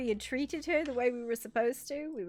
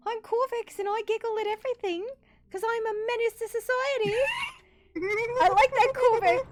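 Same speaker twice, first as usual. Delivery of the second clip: higher, giggly menace voice; Corvex voice; 10 semitones higher, 5 dB louder than usual. Acted. Player voicing Corvex to the table, higher and giggly. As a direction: play the giggling little menace. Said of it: "When I do Corvex's voice, my voice gets higher".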